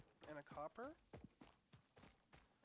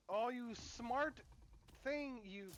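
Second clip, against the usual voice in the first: high, slow cooing praise; 5 semitones higher, 5 dB louder, much slower than usual. Real, not acted. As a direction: coo at it like a pet. Praising pet slug in game.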